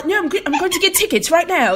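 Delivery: high, fast, bubbly mock admirer; gushing European fan. Imitating Europeans impressed by theater students, gushing high. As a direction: gush up high like a thrilled stranger.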